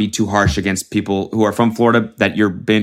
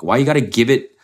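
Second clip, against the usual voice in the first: normal talking voice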